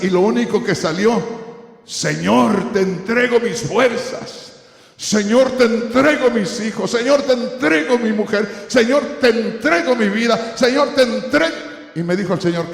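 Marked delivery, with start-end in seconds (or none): 1.8-11.5 rising, louder pleading prayer, higher; imploring offering, voice lifting with each line; fervent prayer. Retold or imitated. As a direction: pour yourself out to the Lord, climbing. Retold fervent prayer on knees.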